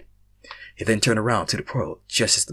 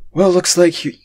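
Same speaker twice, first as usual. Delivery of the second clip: stranger's greeting; 5 semitones higher, 8 dB louder than usual. Acted. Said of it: "a voice said behind me".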